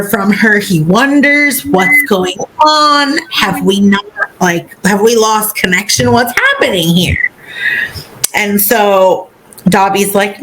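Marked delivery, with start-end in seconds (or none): none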